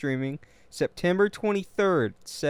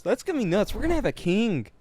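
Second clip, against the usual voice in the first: ordinary talk, nothing marked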